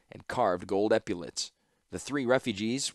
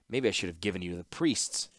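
ordinary delivery, nothing marked

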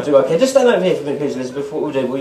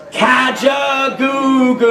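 magic word; 3 semitones higher, much slower than usual. Acted. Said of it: "Can you all say that?".

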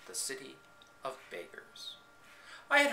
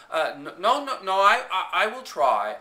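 frightened stammer, rising louder; 5 semitones higher, 7 dB louder than usual. Acted. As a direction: stumble over the words in fear. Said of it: "I stammered".

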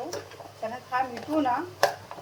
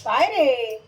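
louder, quick fond retort; calling to the parrot; 2 semitones higher, 7 dB louder, much slower than usual. Real, not acted. Cook answers her parrot, louder. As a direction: answer the bird's noise.

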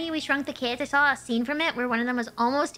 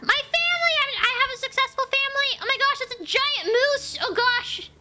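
high, excited cry of discovery; excited exclaim; 11 semitones higher, 3 dB louder than usual. Real, not acted. Player realizing the coyote is dreaming, high and thrilled. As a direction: blurt it out in delight.

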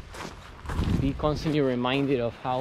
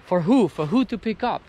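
indignant outburst; 5 semitones higher than usual. Real, not acted. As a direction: demand an answer from the litterers. Indignant rant about abandoned rubbish.

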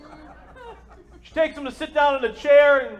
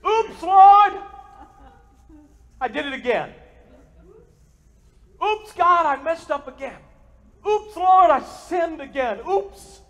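sheepish confession; 4 semitones higher, 5 dB louder than usual. Pretend. Confessing sinner repeating oops.

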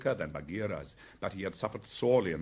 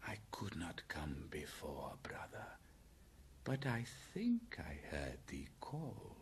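soft snake voice, hushed; a hint of the python, speaking very quietly; lightly voiced python; 4 semitones lower, 11 dB quieter than usual. A narrator's reading. Python speaks softly to Bagheera.

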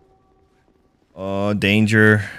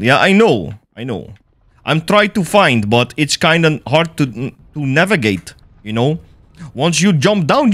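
put-on Russian accent while gaming. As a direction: play the comrade with a hammy Russian accent. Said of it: "Russian accent, comrade".

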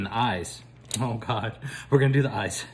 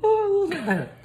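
high delighted squeal; 4 dB louder than usual. Real, not acted.

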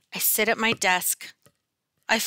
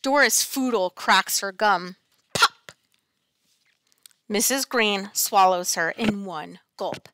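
usual speaking voice

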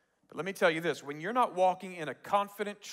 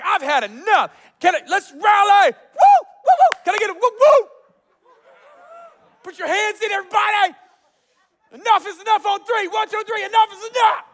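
high shouted rally with whoops; pumped up; 12 dB louder than usual. Retold or imitated. Gideon rallying his men for war, pumped up. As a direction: whip the crowd into a yelling frenzy. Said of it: "he's so pumped up".

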